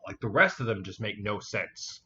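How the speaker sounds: ordinary talk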